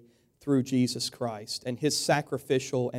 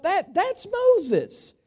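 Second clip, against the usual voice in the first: lightly voiced puzzled George W. Bush, slowing down at the end; a hint of awed recognition, drawn out; half-acted wondering aside to himself; squinting wonder; 6 dB louder, much slower than usual. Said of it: "thinking to himself".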